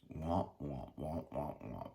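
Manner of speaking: low, soft, droning womps; mocking womp-womp. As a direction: imitate nagging heard as dull noise. Mocks how nagging sounds to them.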